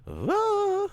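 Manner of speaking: very high, loud squealing ooh; falsetto squeal. Pretend. Gamer squeals after naming the Lake Town archer, falsetto. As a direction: let out a shrill cartoon ooh. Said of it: "All right, I'm done, officially".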